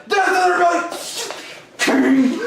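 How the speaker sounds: high, drawn-out propaganda cry; screamed slogan. Acted. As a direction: blurt it out as a shrill mock battle cry. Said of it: "rolling around screaming".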